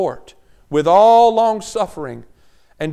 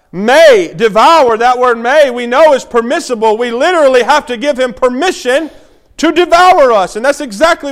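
louder, emphatic sermon cadence; preaching; 6 semitones higher, 7 dB louder than usual. Acted. Pastor warning the church about the devil, emphatic preaching. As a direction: lean on "may" and stretch "devour". Preaching the roaring lion verse.

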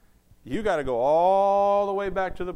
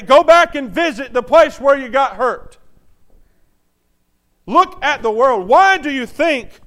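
preaching at full force; 6 semitones higher, 11 dB louder than usual. Acted. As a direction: dare the church, louder.

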